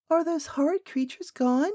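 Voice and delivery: put-on high, light little girl's voice; a small girl asking anxiously; child princess, high and worried; young princess. A narrator's reading. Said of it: "asked the princess".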